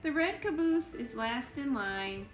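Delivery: ordinary delivery, nothing marked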